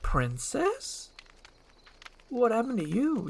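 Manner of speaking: high, hesitant disbelief, no longer shouting; quieted wonder. Acted. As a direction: ask gingerly, stunned. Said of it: "and he quiets down".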